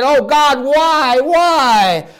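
high, loud anguished cry to heaven. Acted. David on the balcony crying out to God, anguished.

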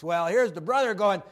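usual speaking voice

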